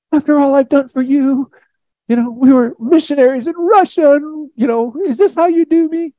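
very high, put-on whine; hammed-up wounded pleading in a squeaky-high voice; mock whiny complaint. Aggrieved missionary complaining to God after all his service, mock whine.

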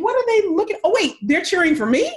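high, dawning mock disbelief; puzzled turning to delighted surprise; retold astonishment. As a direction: act out amazed realization, half laughing. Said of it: "I'm like".

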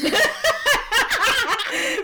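high, loud laughing fit; high burst of laughter. Real, not acted. Laughs at own joke.

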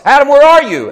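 very high, loud, desperate shout; God calling urgently for Adam; searching call. Acted. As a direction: cry out across a garden for someone lost. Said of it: "looking for all of us".

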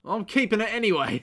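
high, hammed-up defiant copper; crooked cop. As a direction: refuse as a cartoon crooked policeman.

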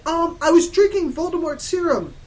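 high, loud mock wail; hammed-up sorrowful confession; sad teacher. Professor Sinister confessing to a student, sad. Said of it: "Professor Sinister looked sad".

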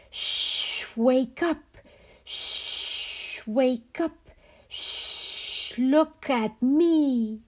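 hissed shh, then high calls to wake; a river serpent hissing them awake; hissing serpent whisper. Acted.

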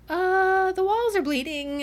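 high, drawn-out 'uh', then easing down; lightly voiced oddball. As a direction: lightly mimic someone not all there. Voicing Winter, out of it.

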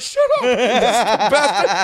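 high, drawn-out mock rebuke breaking into laughter; hammed-up slow, high protest; imitated tearful men. Crying guys silencing their wives, mock-emotional high rebuke. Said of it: "and guys are like".

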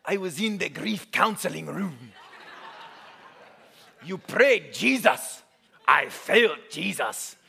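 slow, deliberate mock African accent; hammed-up African accent, word by word; bad African accent. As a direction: testify slowly in a badly faked African accent. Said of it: "that's a horrible African accent".

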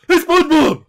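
very high, loud goofy cartoon starfish; Patrick Star voice. Performing the requested cartoon voice for friends, very high and loud. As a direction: belt it out like a dopey cartoon sidekick. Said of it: "I wanna hear that, [name]".

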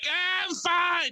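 very high, drawn-out wail; mock cry after a staged tumble; hammed-up high-pitched reassurance; imitated fallen neighbour.

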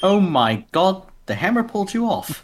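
playful high character voice; Korg impression. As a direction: imitate Korg, pitched well up. Playing Korg after the impression.

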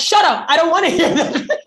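high, laughing retort; half-joking brush-off through laughter; laughing mock snap. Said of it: "And I was like".

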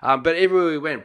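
normal talking voice

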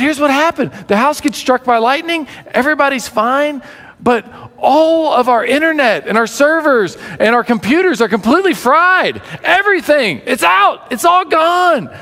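very high, building mock excitement; hammed-up excited disaster report; dramatic reveal. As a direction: build to a high-pitched climax as the wife. Wife reveals all electronics fried.

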